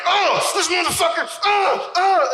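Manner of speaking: high mock outraged groan at the TV.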